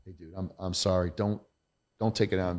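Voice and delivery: soft, sincere sorry; sincere apology. Retold or imitated. Calling his agent back to apologise, soft and sincere. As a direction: apologise gently to a colleague. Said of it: "call him up right away and say".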